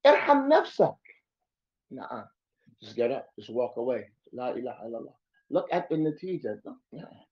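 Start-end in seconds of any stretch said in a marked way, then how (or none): none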